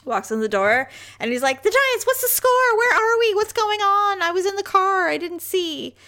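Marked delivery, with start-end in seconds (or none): 1.6-6.0 high, loud, excited husband; high-pitched voice. Retold or imitated. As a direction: squeal it like an eager fan. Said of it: "I finally get a high-pitched voice from you".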